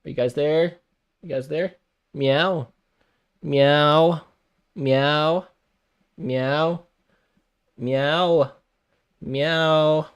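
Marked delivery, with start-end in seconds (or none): 2.1-10.1 playful higher mock meows; cat meow. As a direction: meow like a cat to get attention. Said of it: "playful cat sounds".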